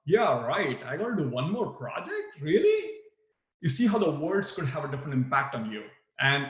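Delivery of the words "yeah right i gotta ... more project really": sarcastic. Shown as a demonstration. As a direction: roll your eyes at one more project.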